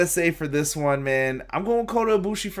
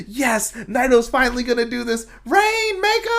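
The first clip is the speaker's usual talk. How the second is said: excited quote; 8 semitones higher than usual. Retold or imitated. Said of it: "I'm like".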